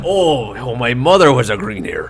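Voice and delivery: loud dwarf bragging, swooping on each repeat; boastful dwarf. Acted. Dwarf boasting of his lineage.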